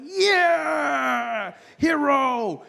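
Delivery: high, drawn-out mock cheer; hammed-up victory cry; triumphant cheer. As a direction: whoop it like a self-declared hero. Celebrates dodging the car.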